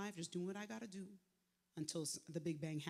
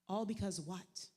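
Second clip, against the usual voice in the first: usual speaking voice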